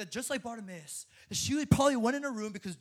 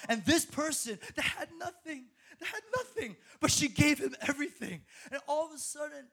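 laughing with joy; 7 semitones higher than usual. Real, not acted. Moved by the woman's gift.

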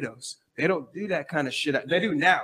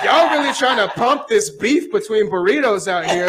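louder, laughing, incredulous; laughing disbelief; 5 semitones higher, 6 dB louder than usual. Retold or imitated. Telling the hosts about burrito rivalry, a loud laughing disbelief. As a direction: laugh through the question in disbelief. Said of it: "I'm like".

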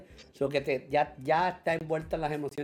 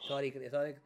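normal talking voice

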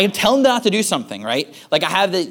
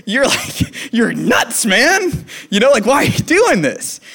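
mock-scold a wild toddler; exasperated teasing; 6 semitones higher, slower than usual. Dad talks to tantruming toddler.

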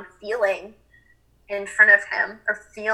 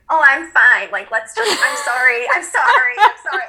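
mock brushing off; 4 semitones higher, 5 dB louder than usual. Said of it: "to be like".

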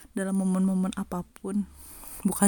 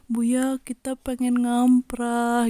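drawn-out, higher-pitched plea; wheedling request; 4 semitones higher, much slower than usual. Retold or imitated. Students asking Buya for a night visit, slow wheedling plea. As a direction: ask sweetly, stretching the words. Students begging the teacher, retold.

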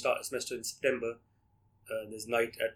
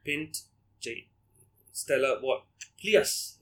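Welsh accent imitation; 3 semitones higher, 4 dB louder, much slower than usual. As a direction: mimic the Welsh barmaid, pitch climbing. Imitating the barmaid's request.

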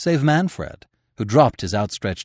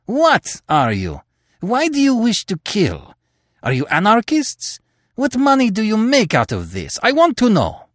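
demanding outburst; 13 semitones higher, 4 dB louder than usual. Acted. Terry's angry interrogation.

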